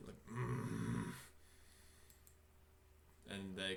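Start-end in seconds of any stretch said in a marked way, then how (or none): 0.3-1.1 low guard's grunting growl. Acted. Burly guards growl at the visitors, low growl. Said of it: "kinda give a bit of a growl".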